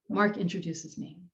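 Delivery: ordinary delivery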